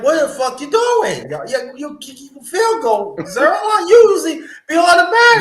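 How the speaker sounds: rising angry shout at the missed kick; retold yell at kicker. Fan yelling at his kicker over a missed field goal, angry shout. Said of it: "I'm like".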